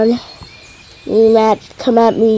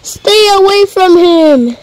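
mock yell; 7 semitones higher than usual. Mom defends with broom.

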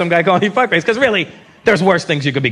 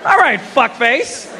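mock tough; 6 semitones higher, much slower than usual. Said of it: "I'm like".

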